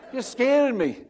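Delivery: laughing high pretend fright, repeated; mock scared. Pastor voicing the Bible as scared, laughing again.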